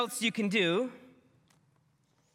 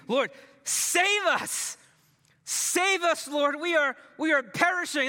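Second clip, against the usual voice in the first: frantic plea; 7 semitones higher than usual. Acted. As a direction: plead like a drowning man. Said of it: "they frantically wake him up".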